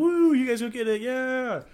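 high, loud cheerleader whoop; mock cheer. Cheering on the pre-med students, high and loud. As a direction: cheer them on like a hype squad.

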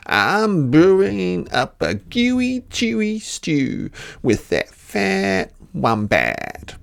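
high, slow, drawn-out dingo voice; storybook dingo, slow and high; clever dingo. A narrator's reading. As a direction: relish each word, high and stretched.

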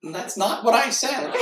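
high cartoon voice breaking into laughter; object, then crack up in high giggles; laughing protest. Sponge denying the smoke shop joke, laughing.

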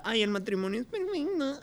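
high, soft fake sob; mock crying. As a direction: whimper it like a crybaby. Mocking Vanessa, crying voice.

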